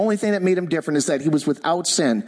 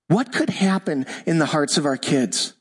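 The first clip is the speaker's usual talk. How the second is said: usual speaking voice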